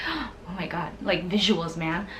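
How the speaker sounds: retold soft, low starstruck aside; hushed awe. Fan dazzled by idol's visuals, hushed and low. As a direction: drop to an awed hush.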